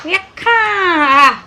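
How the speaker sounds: high laughing wail; half laugh, half sob; laughing crying. Real, not acted. Laugh-cry over pension.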